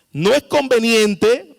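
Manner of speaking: ordinary talk, nothing marked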